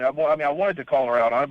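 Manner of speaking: normal talking voice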